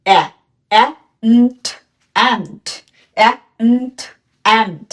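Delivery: teacher segmenting a word for beginners; sound-by-sound drill. Shown as a demonstration. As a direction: split and into অ্যা then nt, then blend. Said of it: "ওর উচ্চারণ কি হবে".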